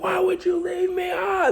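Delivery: high far-off whiny voice; whiny complaint. Retold or imitated. Friend left behind, high whiny complaint. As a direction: sound hurt and whiny.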